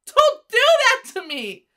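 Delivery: loud, high, half-laughing outcry; startled protest. Real, not acted. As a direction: shriek at the surprise, then complain. Reacting to the shock of the bass.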